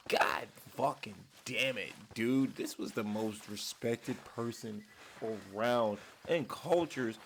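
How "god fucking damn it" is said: usual speaking voice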